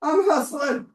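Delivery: high, loud, breathless rush; racing against the clock; excited. Real, not acted. Racing the clock, finishing build.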